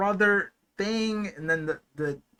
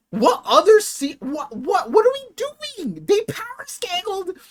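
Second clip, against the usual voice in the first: much higher, squealing excitement; excited disbelief; 13 semitones higher, 4 dB louder than usual. Real, not acted. Fan squealing at the characters power scaling, excited. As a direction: voice cracking up high in disbelief.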